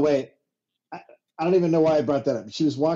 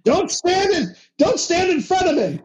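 mock panicked yell; 11 semitones higher, 3 dB louder than usual. Mock cops yelling warning.

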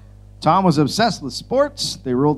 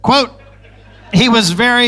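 loud reading voice; 8 semitones higher, 6 dB louder than usual. Acted. Reading quote, loud high.

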